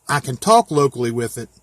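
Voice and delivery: ordinary delivery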